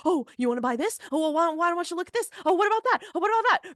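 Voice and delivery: high, fast, pushy salesman voice; mock a sweaty car salesman pushing products. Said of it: "sweaty car salesman that's like".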